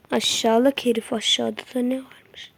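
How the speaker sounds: unvoiced whispered question; creeping whisper asking what's below. Real, not acted. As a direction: breathe it out secretly. Whispered question while exploring.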